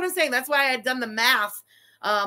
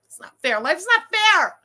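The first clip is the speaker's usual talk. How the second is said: mock protest; 5 semitones higher, 8 dB louder than usual. Fan complaining.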